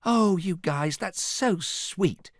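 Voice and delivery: high, drawn-out gratitude; touched. A narrator's reading. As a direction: coo it, moved by the gift. Fiona touched by gifts.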